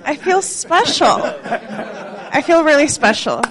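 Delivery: laughing through the words, pleased; real laughter while taking in a compliment. Real, not acted. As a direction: giggle it out, slow and delighted.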